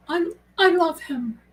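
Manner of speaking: lightly played swooning period-drama lady; Elizabeth Bennet voice. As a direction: sigh it out like a smitten Austen heroine. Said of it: "I sound like what's her face on Pride and Prejudice, Elizabeth Bennet".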